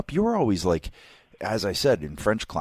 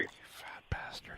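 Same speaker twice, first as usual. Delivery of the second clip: whispered teasing jab; whispered insult to a friend who ate fried chicken; 18 dB quieter than usual. Real, not acted. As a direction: breathe it out under your breath. Teasing friend about eating.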